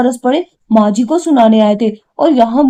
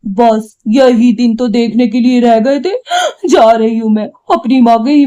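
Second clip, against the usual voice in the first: tearful. Acted. Wife cries after husband's scolding.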